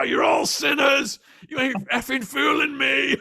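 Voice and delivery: high, loud, slow taunting yell in a put-on voice; crazed pirate shout at the front row; mocking shout. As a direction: jeer at the congregation. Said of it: "and goes".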